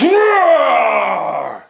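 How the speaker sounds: loud, high shouted lion roar. Acted. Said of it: "The lion growls".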